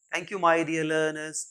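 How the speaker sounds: ordinary talk, nothing marked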